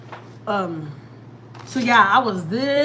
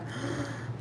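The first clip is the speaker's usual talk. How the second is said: quick acted-out gasp; mock shocked gasp; 4 semitones higher, 13 dB quieter than usual. Boss seeing what the employee showed her, shocked gasp. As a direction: imitate the boss's sharp intake of breath.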